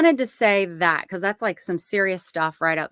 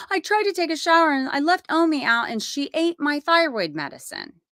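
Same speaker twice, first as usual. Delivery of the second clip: higher and fast, tumbling out; rushed daughter; 8 semitones higher than usual. Retold or imitated. Tori explaining to mom what the dog ate, higher and rushed. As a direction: rattle it off like a flustered daughter.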